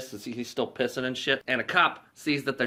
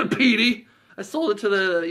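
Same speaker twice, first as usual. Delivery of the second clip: higher and louder goofy Lloyd; 8 semitones higher, 5 dB louder than usual. Retold or imitated. Lloyd confessing to Harry, lightly voiced goofy friend. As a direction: blurt it out like a dim friend.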